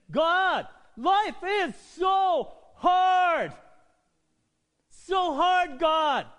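anguished full-voiced shout, high and drawn out; crying out to God. Preacher acts out David crying out to God, desperate shout. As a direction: wail it loudly, stretching each word to heaven. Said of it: "he's saying, crying out to God".

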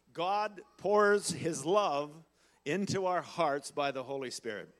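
preacher declaring to the church, slow and loud; slow proclamation. Real, not acted. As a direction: lay out the words slowly and heavily.

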